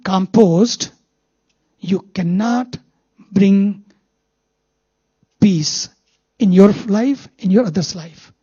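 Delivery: usual speaking voice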